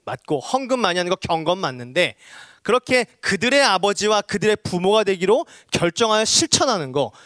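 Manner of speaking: ordinary talk, nothing marked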